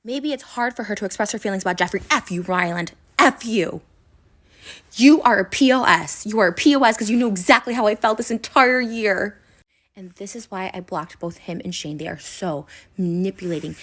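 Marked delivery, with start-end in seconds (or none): 3.2-9.4 shouting F you and P O S in real anger; furious outburst. Real, not acted. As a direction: let the anger out at him. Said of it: "angry outburst at Ryland".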